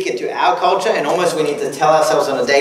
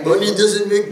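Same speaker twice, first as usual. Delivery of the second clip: mock Austrian Arnie accent, giving up halfway; a bad, half-hearted Austrian Arnie impression; 4 semitones higher than usual. Preacher retries quoting Arnold Schwarzenegger in a badly faked Austrian accent. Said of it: "no, I can't do it".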